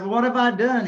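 dismayed plea. Retold or imitated. Pleading with banker after the bad news.

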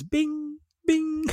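high imitated phone ping; notification ping. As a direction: mimic a phone pinging high.

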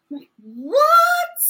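high, loud disbelieving what; outraged disbelief, thrown out; incredulous. Real, not acted. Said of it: "baffled at follow button".

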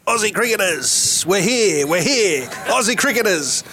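high, slow call across the station; drawn-out mock call to find the club. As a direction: hail the waiting locals from the platform. Holding jumper up, calling out.

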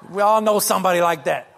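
usual speaking voice

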